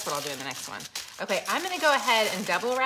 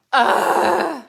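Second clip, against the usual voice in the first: loud, higher groan of disgust; disgusted ugh; 8 semitones higher, 14 dB louder than usual. Real, not acted. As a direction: groan loudly, grossed out. House smells of Worcestershire.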